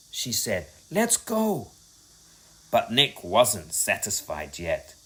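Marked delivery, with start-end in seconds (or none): 0.9-1.7 scared pleading. A narrator's reading. Scared girl wants to leave.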